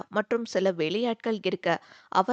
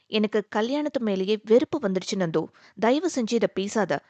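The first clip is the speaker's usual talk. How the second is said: ordinary delivery, nothing marked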